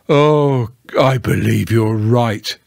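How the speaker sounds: sighing, resigned admission; long sigh. A narrator's reading. Eeyore giving in to Pooh, sighing. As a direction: let it sink out on a sigh. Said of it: "with a long sigh".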